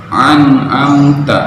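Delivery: an'amta with a throaty ain; slow an'amta, ain from the throat. Shown as a demonstration. (an'amta dengan huruf ain)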